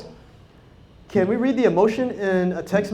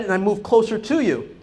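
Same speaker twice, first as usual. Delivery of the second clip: ordinary delivery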